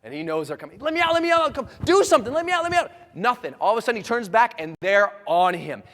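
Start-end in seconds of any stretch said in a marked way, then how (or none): 0.8-2.9 high, loud frantic begging; freaking out. Retold or imitated. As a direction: play a trapped man yelling in panic. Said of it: "he's freaking out".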